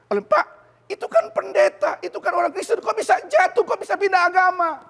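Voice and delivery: high, loud incredulous churchgoer; mock questioner. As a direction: scoff like a shocked church member.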